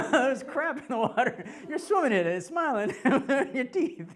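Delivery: high, loud words through laughter; real cackling laughter breaking up the words; laughing hard. Real, not acted. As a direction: barely get it out for laughing. Cracking up telling sewage swim.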